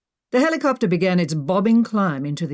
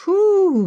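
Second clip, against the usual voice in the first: high, loud blown-out whew; hot and tired whew; exclaimed whew; 14 semitones higher, 6 dB louder than usual. Acted. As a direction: puff it out like the heat's too much. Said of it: "Maggie exclaimed".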